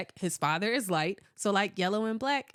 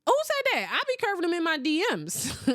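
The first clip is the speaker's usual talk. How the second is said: indignant surprise; 8 semitones higher than usual. Real, not acted. Reading a chat comment aloud, outraged. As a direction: demand who said it, shocked.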